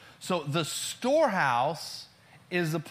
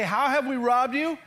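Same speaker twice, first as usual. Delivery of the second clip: high, louder protest at the end; protesting question; 9 semitones higher, 5 dB louder than usual. Retold or imitated. God's people answering the robbery charge, protesting question. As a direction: raise it into an incredulous question. Reading the people's reply to God.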